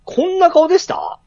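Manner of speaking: surprised. Real, not acted. Doubting a photo's face.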